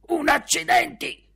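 high, loud scornful snort of a retort; scoffing father. Acted. Father dismissing the guests' jobs, loud scornful retort.

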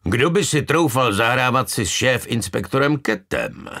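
booming mock outrage; rough voice. A narrator's reading. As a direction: challenge as a gruff boss. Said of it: "odpověděl hrubý hlas".

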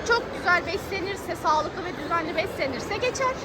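ordinary talk

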